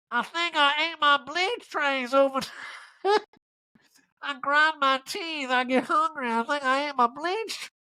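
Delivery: very high, slow, breaking into laughs; Erica impression, laughing. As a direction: stretch it out through giggles. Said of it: "riffing the Erica voice".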